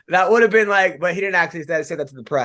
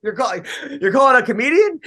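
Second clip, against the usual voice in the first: higher-pitched, laughing disbelief; incredulous; 7 semitones higher, 4 dB louder, faster than usual. Retold or imitated. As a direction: retell a baffled reply through a chuckle. Retells his baffled reply to caller.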